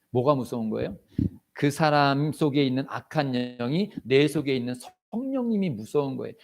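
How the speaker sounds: normal talking voice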